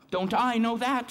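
bitter knowing aside, voice jumping up; sharp rueful quip to himself; rueful retort. Real, not acted. Rueful aside after angry verse.

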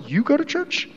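high, soft, incredulous rising question. Retold or imitated. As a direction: ask it like you can't believe it.

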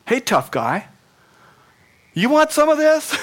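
high, loud mock taunt; mock tough guy. Jesus jokingly imagined taunting Saul, mock bully. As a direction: goad him like a schoolyard bully. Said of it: "Jesus could have said to him".

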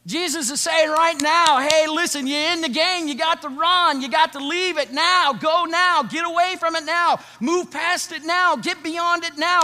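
very high, loud urgent cry; impassioned preaching shout. Acted. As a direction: drive it home at full force. Preaching at full force climax.

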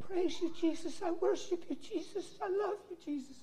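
high, very soft imitated girl's prayer; girl softly praising. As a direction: breathe out tender worship as the girl, high and hushed. Voices the girl praising Jesus.

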